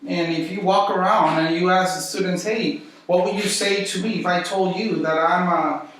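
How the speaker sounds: usual speaking voice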